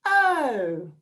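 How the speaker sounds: "Oh." said as a dismayed oh-no; oh as if something went wrong. Shown as a demonstration. Teacher showing how to say the sound as if something went wrong. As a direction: sound it like a small mishap. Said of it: "we pretend that something has gone wrong and we go".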